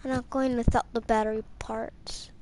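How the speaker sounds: hushed whisper, almost no voice. Real, not acted. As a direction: whisper it stubbornly. Quiet stubborn reply in game.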